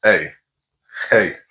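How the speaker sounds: ordinary talk, nothing marked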